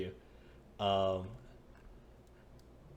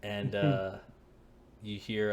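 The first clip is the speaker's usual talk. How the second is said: usual speaking voice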